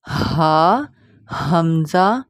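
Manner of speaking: ہا and ہمزہ weighty, drawn out; loud, held ہا and ہمزہ; heavy letters. Shown as a demonstration. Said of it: "ان کو اس طرح پڑھنا ہے".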